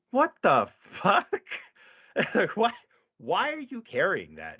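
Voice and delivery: mock shock, stretched out; incredulous. Cop reacts to a hipster pistol, in high mock shock. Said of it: "And I'm like".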